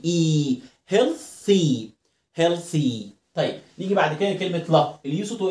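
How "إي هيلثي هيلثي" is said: "هيلثي" slowly, drawing out the final ee; final long ee. Shown as a demonstration. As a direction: stretch the last vowel of "هيلثي" into a long ee. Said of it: "انطق الواي لونج إي".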